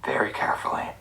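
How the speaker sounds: whispered very carefully. Retold or imitated. Whispering as he presses each button very carefully. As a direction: hush it like you're defusing a bomb.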